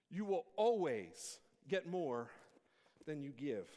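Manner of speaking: ordinary talk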